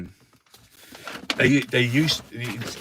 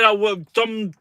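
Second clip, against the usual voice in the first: higher, smug mocking politician voice; lightly mocking posh minister; mocking impression; 7 semitones higher than usual. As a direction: send up the PM gleefully. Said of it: "He said".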